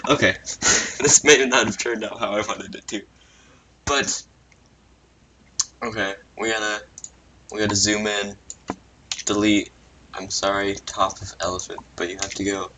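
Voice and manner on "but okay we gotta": usual speaking voice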